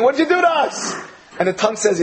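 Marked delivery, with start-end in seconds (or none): 0.0-0.9 high, lightly hammed-up cry of alarm; body parts wailing in mock panic; mild mock scream. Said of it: "start screaming".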